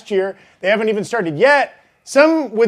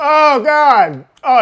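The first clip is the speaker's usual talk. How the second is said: high, pained groan of disgust; grossed out; 6 semitones higher, 6 dB louder, much slower than usual. Real, not acted. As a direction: groan at the salty taste. After eating salty shrimp.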